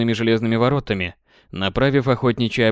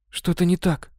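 higher, light female voice; Kate's voice; 7 semitones higher than usual. Acted. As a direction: voice Kate, worried and higher. Kate voiced by narrator. (голос Кэтрин, встревоженно)

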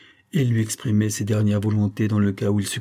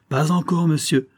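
rire amer; 6 semitones higher than usual. Acted. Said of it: "avec un rire amer".

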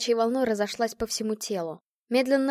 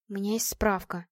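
whispering; 2 semitones lower, 6 dB quieter than usual. A narrator's reading. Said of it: "прошептала она".

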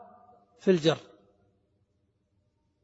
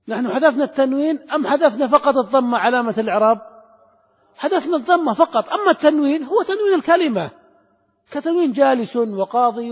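higher and louder, hammering the point home; emphatic insisting; 5 semitones higher, 5 dB louder than usual. Real, not acted. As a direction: insist on it to the class, loud and animated. Teacher insisting on his point. (المعلم يلحّ على طلابه بحماس وصوت مرتفع)

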